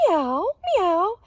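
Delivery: high loud meow meow; cat meows. Acted. Reading to kids, voicing the cat, high meows. Animal sounds in picture book.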